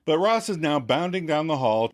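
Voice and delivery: normal talking voice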